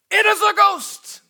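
high, loud shout of terror; fearful cry. Acted. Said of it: "they cried out in fear".

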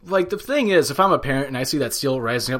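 ordinary talk

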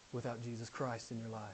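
very low, soft sermon voice; quiet, searching appeal to the congregation; hushed, grave preaching. Real, not acted. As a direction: drop right down to a solemn hush.

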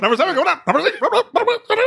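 high, babbling horse race caller; fast race announcer. Acted. Host mocks the announcer talking really fast, race-caller gabble. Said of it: "talking really fast".

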